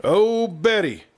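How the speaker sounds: high, slow, drawn-out call; long ringing shout of her name. A narrator's reading. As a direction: call out to her across the yard. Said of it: "he shouted".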